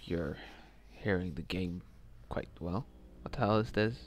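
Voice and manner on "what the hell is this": ordinary delivery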